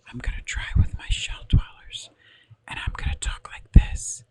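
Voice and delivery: close-mic ASMR whisper. Shown as a demonstration. Streamer trying ASMR for her viewers, whispering. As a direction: whisper it loudly, right into the microphone. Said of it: "why am I whispering?".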